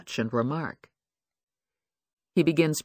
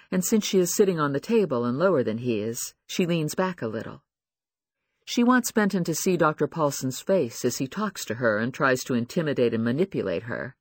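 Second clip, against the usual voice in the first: ordinary delivery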